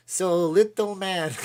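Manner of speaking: high, loud, slow hammed-up Russian accent; John Malkovich's Russian accent. Quoting the movie Rounders, Russian accent. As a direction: draw it out in a thick Russian accent. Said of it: "John Malkovich has got the best Russian accent".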